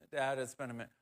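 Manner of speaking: soft, mumbled admission; sheepish son. Retold or imitated. Grown son confessing to his father, soft and sheepish. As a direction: own up quietly, a bit embarrassed.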